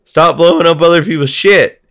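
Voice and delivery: mock exasperation rising to a high, loud yell; exasperated yell. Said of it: "And you're like".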